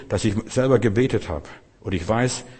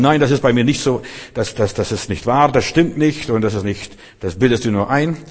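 loud, insistent mock protest; indignant contradiction; 2 semitones higher, 5 dB louder than usual. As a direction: act out someone proudly arguing back. Spielt stolzes Widersprechen der Menschen nach. (lautes, nachgespieltes empörtes Widersprechen)

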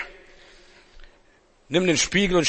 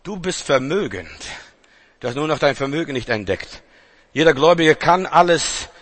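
ordinary talk

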